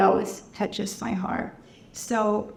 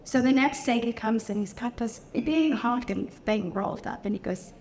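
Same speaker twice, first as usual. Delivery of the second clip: usual speaking voice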